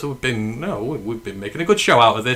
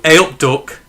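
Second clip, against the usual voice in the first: "A up, Duck" said higher and louder in a Midlands accent; a lifted Midlands accent greeting; 4 semitones higher, 5 dB louder, slower than usual. Shown as a demonstration. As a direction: call out the Midlands greeting brightly. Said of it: "That is where we're at here".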